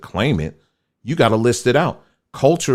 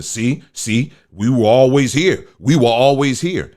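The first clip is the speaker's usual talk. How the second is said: raised, louder voice imitating people insisting; higher-pitched mocking quote; mock insistent claimant; 5 semitones higher, 4 dB louder than usual. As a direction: mimic someone eagerly pushing their claim. Mimicking people pushing fake pictures.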